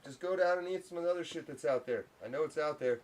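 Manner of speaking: quietly telling the cat to go; soft coaxing to cat. Real, not acted. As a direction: shoo the cat away gently. Talking to the cat.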